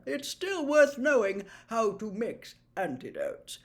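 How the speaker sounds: put-on jovial, booming old professor; plummy teacher inspecting the class's work; Slughorn's voice. Slughorn to the class, checking potions, jovial booming.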